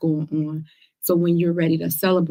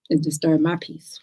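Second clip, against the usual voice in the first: usual speaking voice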